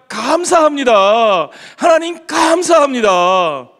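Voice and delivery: loud, high, drawn-out thanks. Shown as a demonstration. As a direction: call out the thanks to God. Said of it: "따라해 봅시다".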